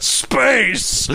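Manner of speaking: high, dramatic mock exclamation; hammed-up cry. Host quoting Red Alert for co-host, hammed-up cry. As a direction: belt it out like a hammy actor. Mimicking a game's famous line.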